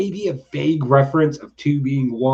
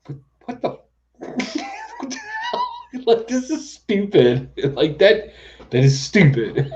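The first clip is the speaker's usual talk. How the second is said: laughing disbelief; 10 semitones higher, slower than usual. Retold or imitated. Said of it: "And I'm like".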